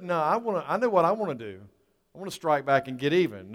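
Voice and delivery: normal talking voice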